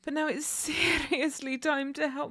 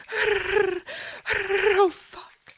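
playful growl; 3 semitones higher, 6 dB louder, much slower than usual. Acted. Louder growling.

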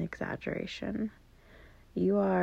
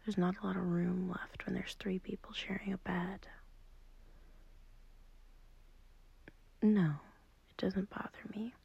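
hushed whisper, much softer; 5 dB quieter than usual. Acted. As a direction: whisper so the sleeper beside you doesn't wake. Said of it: "I'm whispering because I don't want to wake up".

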